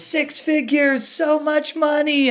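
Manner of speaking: high, drawn-out awe at the money; starry-eyed over a big paycheck; dazzled. Retold or imitated. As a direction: savor the amount like a dream. Excited about six figure promise.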